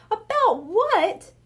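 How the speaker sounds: slow, high incredulous echo; disbelieving. A narrator's reading. Bradley echoing his brother in disbelief. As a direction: repeat it, stunned. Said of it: "Bradley repeated, not believing his ears".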